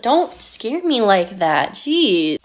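ordinary talk, nothing marked

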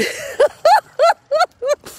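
a high, giddy real laugh; high burst of laughter. Real, not acted. Laughing after admitting she is still a woman, giddy. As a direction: laugh out, caught off guard. Laughing at her own confession.

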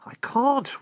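high, loud pleading; desperate protest. A narrator's reading. Boy protests while held captive.

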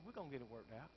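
soft, low, easy assurance; calm reassurance. Retold or imitated. Overseer calms the worried pastor, soft and low. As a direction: settle him down gently.

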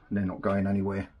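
ordinary delivery

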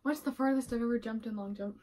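normal talking voice

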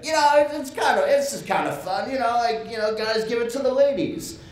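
high, dopey mock voice of the bar owner. Mocking the brewery owner's excuse, high goofy voice. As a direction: ham up his lame excuse.

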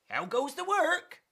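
high-pitched farmer's call. Acted. Farmer calls to duck repeatedly.

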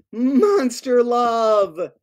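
very slow, drawn-out happy refrain; slow final refrain. Acted. Author ends the book for the kids, slow happy refrain. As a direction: savor the last line.

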